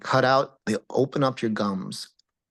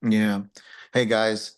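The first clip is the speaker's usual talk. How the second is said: ordinary talk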